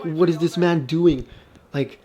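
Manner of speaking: mock bafflement, breaking off into a puzzled "huh"; baffled. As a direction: act out being confused out of your mind. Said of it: "just confused out of my mind".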